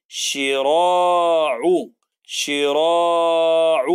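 شراع very slow, the ra drawn out; stretched شراع. Shown as a demonstration. Teacher reading شراع with a long, very slow vowel. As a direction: hold the a in شراع. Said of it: "مديت وطولت في الصوت".

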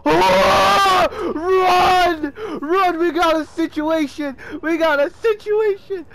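high, loud panicked yells; panicked shouting. Real, not acted. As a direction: yell a warning in full panic. Panic at giant machine.

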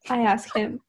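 usual speaking voice

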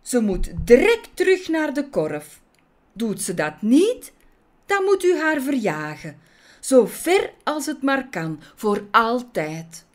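loud, high, raging judge; irate ranting, slowing on the final words; furious demand. Acted. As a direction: demand her banishment in a fury. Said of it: "ontstak hij in woede".

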